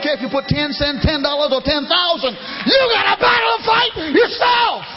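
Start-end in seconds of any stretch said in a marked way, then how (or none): 2.7-4.8 very high, loud cry; preaching shout. Real, not acted. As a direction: cry it out to the church. Preacher exhorting the congregation.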